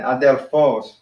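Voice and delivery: Adelphos with a drawn-out, accented PHOS; Adelphos, final syllable stressed; stress on last syllable. Shown as a demonstration. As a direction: put the accent at the end of Adelphos. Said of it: "put the accent at the end".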